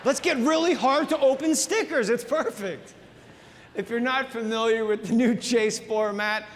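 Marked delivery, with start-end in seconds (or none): 0.1-2.9 high, gleeful sarcastic pitch; sarcastic boardroom brainwave; mock executive. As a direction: sell the bad idea with fake enthusiasm. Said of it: "when nascar executives came up with that idea".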